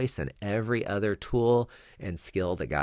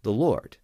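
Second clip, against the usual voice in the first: The Lord said alone, louder after a pause; emphatic repeat; 3 semitones higher, 4 dB louder than usual. Shown as a demonstration. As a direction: drop the words The Lord like a verdict. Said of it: "and then I repeat".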